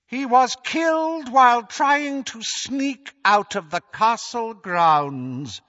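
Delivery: high, slow, cold dark-lord voice; high menacing villain, drawn out; Voldemort gloating. Acted. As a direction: gloat over the fallen hero to the crowd. Voldemort lies to the crowd.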